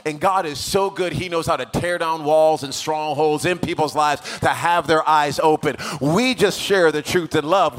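loud, driving sermon climax; impassioned preaching. Real, not acted. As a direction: preach it out at full force. Preaching at full force.

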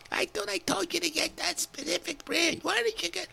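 shrill-edged mock wife telling him off; scolding wife. Wife scolding husband for the wrong brand, high mock nag.

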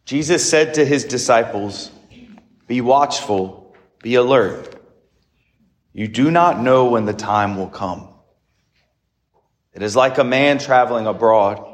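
measured liturgical reading voice; formal Gospel reading. Acted. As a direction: proclaim the Gospel solemnly.

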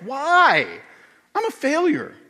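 high, exclaimed comic whine; mock protest. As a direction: wail it in mock despair. Mock retort to self-belief advice.

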